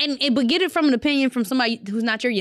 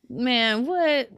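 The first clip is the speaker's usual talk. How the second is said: ordinary talk, nothing marked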